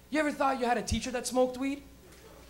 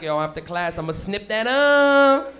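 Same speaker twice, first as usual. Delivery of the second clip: scheming student; 6 dB louder, slower than usual. Retold or imitated. Student plotting TV theft in class, drawn out. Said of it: "Somebody was in class like".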